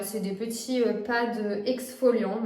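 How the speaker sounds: ordinary talk, nothing marked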